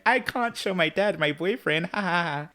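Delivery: high, singsong mock fluster; mock-flustered daughter. Daughter hiding her boyfriend from dad, high mock fluster.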